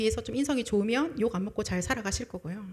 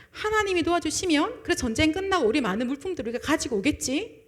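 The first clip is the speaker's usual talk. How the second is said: eager elders; 6 semitones higher, 5 dB louder than usual. Retold or imitated. Preacher voicing the elders, eagerly hopeful. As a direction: play the elders sure they will win.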